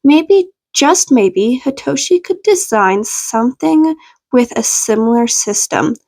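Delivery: ordinary delivery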